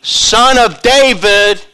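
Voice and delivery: loud, slow drawn-out plea. Retold or imitated. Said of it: "cried out all the more".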